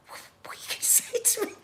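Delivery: hushed, hurried whisper to a sister; retold urgent whisper. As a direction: whisper it quickly, acting out the panic. Secret upstairs talk with sister.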